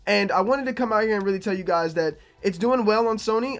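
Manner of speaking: ordinary delivery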